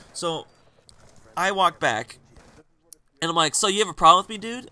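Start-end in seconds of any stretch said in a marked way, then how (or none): 3.5-4.7 put-on louder, challenging the drunk guy; squaring up to him, voice raised; confronting. Narrator confronting the drunk stranger, raised challenging voice.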